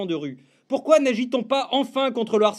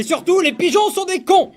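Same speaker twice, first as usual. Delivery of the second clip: loud proclamation; 8 semitones higher, 4 dB louder than usual. Acted. Rant crescendo.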